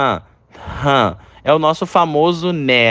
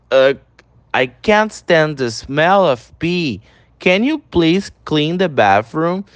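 slow, deliberate English, each word apart; slow teacher demo of the line; slow pronunciation model; slower than usual. Shown as a demonstration. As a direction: model it slowly for students to repeat. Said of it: "repete a pronúncia em todos".

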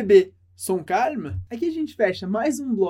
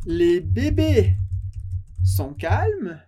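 slow dictation; 4 dB louder, much slower than usual. Shown as a demonstration. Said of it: "Frase dezenove".

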